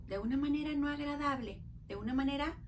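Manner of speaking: normal talking voice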